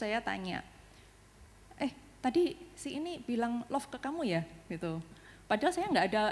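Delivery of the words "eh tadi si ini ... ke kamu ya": a touch of tense interrogation, pitched higher; half-grilling her son, lightly acted; tense question. Mom asking her son while driving, mildly tense and probing. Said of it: "mungkin nadanya nada tegang".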